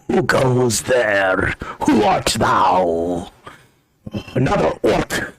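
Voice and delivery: slow, drawn-out challenge in a theatrical dwarf voice; hammed-up wary old dwarf; old blind dwarf, suspicious. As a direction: call warily into the darkness.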